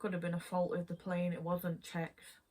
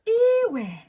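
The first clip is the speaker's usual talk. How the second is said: "Iwe" thrown out high and loud as a teasing joke; a much higher, louder mock-outraged "Iwe"; playful jokey "Iwe" aimed at a friend; joking exclamation; 17 dB louder than usual. Shown as a demonstration. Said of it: "use it as kind of like a joke".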